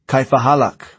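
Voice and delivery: high, loud yelled challenge; yelling. A narrator's reading. Driver yells again at the Americans from the Jeep, yelling. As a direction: yell it out at the men. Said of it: "The driver again yelled".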